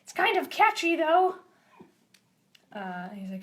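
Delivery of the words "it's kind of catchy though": high, loud cartoon admission; high-pitched comic change of heart; won-over character. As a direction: concede it brightly in a raised character voice. Same character admits liking the song.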